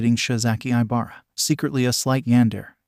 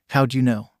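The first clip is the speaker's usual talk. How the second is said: ordinary delivery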